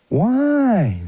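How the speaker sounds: put-on high childlike why; a small boy's puzzled high question; little boy asking. Grandson asking grandpa why, in a high boy voice.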